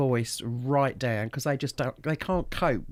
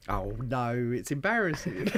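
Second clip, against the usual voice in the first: ordinary talk, nothing marked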